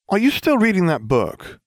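a high, nagging Ron complaining about the book. Acted. Ron's voice, groaning at Hermione.

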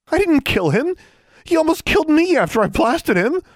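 put-on a frightened teen insisting she is innocent; young girl protesting. Alexandra defends herself.

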